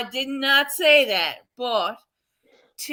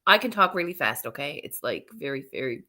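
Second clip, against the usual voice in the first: fast talk; 9 semitones lower, 6 dB quieter, faster than usual. Shown as a demonstration. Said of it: "I talk faster than".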